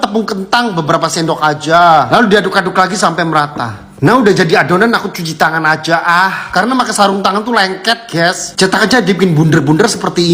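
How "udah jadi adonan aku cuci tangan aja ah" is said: normal talking voice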